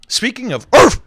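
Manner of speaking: a bright, silly callback; goofy callback. Acted. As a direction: toss it out like a punchline. Jokey callback to bark.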